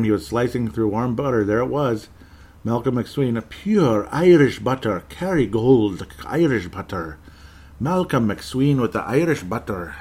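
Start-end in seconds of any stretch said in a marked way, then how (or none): 2.6-9.9 lilting put-on Irish accent, drawn out; playful Irish accent. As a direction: stretch it out in a jokey Irish brogue. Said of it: "I hope you don't mind me doing the accent".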